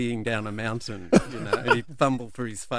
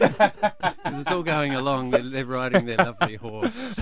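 laughing; 4 semitones higher, 5 dB louder than usual. Real, not acted. Laughing retelling the dream video.

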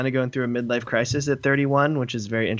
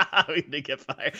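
laughing mock; 3 semitones higher than usual. Mocking friend, laughing.

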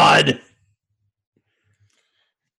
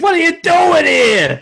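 mock shout; 13 semitones higher, 6 dB louder than usual. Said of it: "He's gonna be like".

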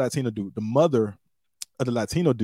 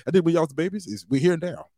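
normal talking voice

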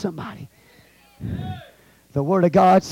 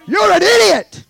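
high, loud preacher's rebuke; scolding shout; 10 semitones higher, 6 dB louder than usual. Real, not acted. Preacher rebukes the doubter, loud scolding.

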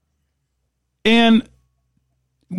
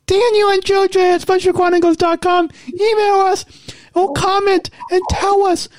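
funny voice; 2 semitones higher than usual. Pretend. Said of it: "my funny voice".

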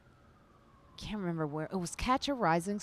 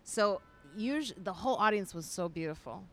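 ordinary talk

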